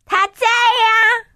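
high, drawn-out happy call. Acted. Daughter revealing herself to Dad, high drawn-out mild shout. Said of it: "我大声喊".

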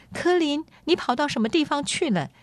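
high, quick, concerned questioning; anxious questioning. Acted. Lady asking the missing prince where he went, anxious questioning. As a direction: press him for an answer, pitched high and hurried.

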